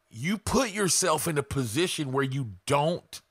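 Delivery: normal talking voice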